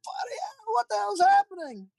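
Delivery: very high, strained mock scream; screaming in panic. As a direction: play a panicked crewman screaming in falsetto. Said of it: "screaming his lungs out, like".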